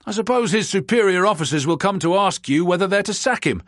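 ordinary delivery

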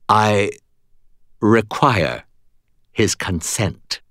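tight, slow and controlled. Acted. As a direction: hold the anger tightly in. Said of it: "her voice tight".

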